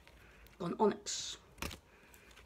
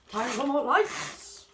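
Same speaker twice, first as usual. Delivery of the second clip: slow, loud, raised announcement; excited announcing; 5 semitones higher, 7 dB louder, slower than usual. Acted. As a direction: call it out big and slow. Opening window, loud announcement.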